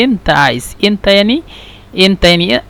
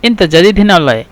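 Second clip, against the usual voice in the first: quick colloquial question; much faster than usual. Shown as a demonstration. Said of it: "نفس الكلام".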